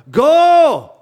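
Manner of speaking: high, loud story-time holler; yelled. Retold or imitated. Bride yells at the carriage horse, hammed-up holler. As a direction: yell it out like an impatient bride. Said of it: "Marie yelled".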